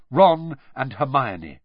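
ordinary delivery, nothing marked

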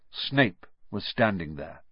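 ordinary delivery, nothing marked

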